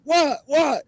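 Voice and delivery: very high frantic back-and-forth; retold flipping out. Storyteller replays pointing out Sasquatch to Gary, frantic high voice. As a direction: act out both sides of a freak-out, squeaking high. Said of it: "I was flipping out".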